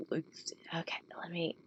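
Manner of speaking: breathy whispered aside; whispering. Real, not acted. Whispering while setting up.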